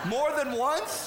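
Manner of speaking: high, slow whining question; whiny kid. Retold or imitated. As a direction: whine it like a kid.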